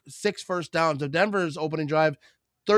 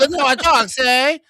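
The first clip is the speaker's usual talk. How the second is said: high, loud old-timey imitation; old-time voice; 7 semitones higher, 6 dB louder than usual. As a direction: insist on it in a vintage put-on voice. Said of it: "Why you talking like that?".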